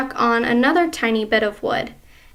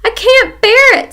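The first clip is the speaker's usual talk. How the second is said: much higher, louder wail; despairing wife; 11 semitones higher, 10 dB louder than usual. Acted. Wife wailing in horror at husband's news, higher, louder. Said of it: "said Missus Twit".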